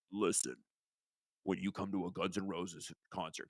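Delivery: mock him as a pompous rock star. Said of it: "in his dumb voice".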